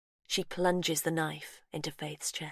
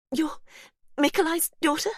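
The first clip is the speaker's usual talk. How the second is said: whimper; 12 semitones higher, 5 dB louder than usual. Acted. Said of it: "Faith whimpers".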